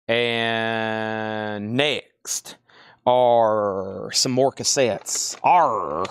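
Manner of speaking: low, slow drawl ending in a pirate growl; accidental pirate voice. Pretend. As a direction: slip into a pirate "arr" without meaning to. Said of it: "I sound like a pirate right then".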